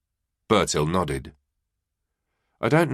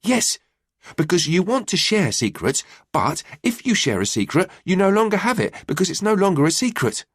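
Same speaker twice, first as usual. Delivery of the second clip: put-on high, quick child voice bursting to explain; eager explanation; 9 semitones higher than usual. A narrator's reading. Boy eagerly explaining the riddle to his mum. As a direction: rush through it, so eager. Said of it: "he eagerly explained".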